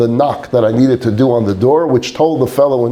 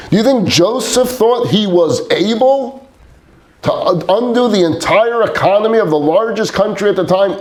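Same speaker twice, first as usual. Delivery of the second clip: much higher, fervent rhetorical questions; animated; 9 semitones higher than usual. Retold or imitated. Father rousing his son with Bible heroes, fervent and high. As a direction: preach it, driving each question up. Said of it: "my father got very animated".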